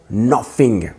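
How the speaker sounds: American-style English pronunciation; American accent. Shown as a demonstration. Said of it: "Vous avez vu l'accent?".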